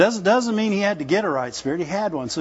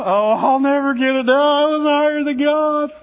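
high, loud mock whining; hammed-up self-pity, pitched up and loud; imitating a loud, high whine; 7 semitones higher, 6 dB louder than usual. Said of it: "I didn't whine".

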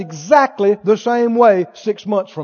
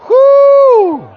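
long, high, loud whoop; big sustained "whoo"; exultant whoop; 8 dB louder than usual. Real, not acted. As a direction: let out a victorious holler. Preacher whoops after rallying cry.

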